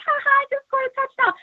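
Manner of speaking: high, gloating mock laugh of a rival quarterback; smug mocking Jameis. [name] mocking the smug Saints quarterback, high gloating voice. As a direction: sneer it like a smug player gloating. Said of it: "Jameis with his smug little smile, all like".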